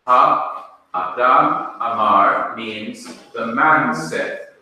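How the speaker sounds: normal talking voice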